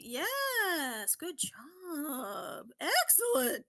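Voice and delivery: high, swooping cheers of praise; excited praise. Real, not acted. Host congratulates the player who guessed right, excited. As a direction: celebrate each word with a big pitch swoop.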